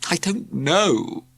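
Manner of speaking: slow, gloomy voice; gloomy reply. A narrator's reading. Worried teacher answers the cat, slow and gloomy. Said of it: "said Heathcliff gloomily".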